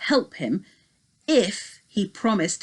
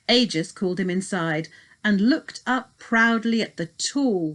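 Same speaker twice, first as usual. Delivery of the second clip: ordinary delivery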